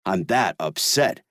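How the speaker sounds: ordinary delivery, nothing marked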